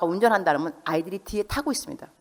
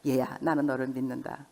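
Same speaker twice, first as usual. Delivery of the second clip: gentle trust; 5 semitones lower, 6 dB quieter than usual. Shown as a demonstration. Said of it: "가장 무서운 말은요, "너를 믿는다".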